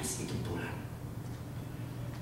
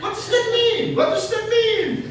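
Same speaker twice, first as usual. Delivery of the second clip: very high, loud, the repeat slower; imitating the puzzled elder; 11 dB louder, slower than usual. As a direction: play a baffled foreigner asking. Elder confused, voiced.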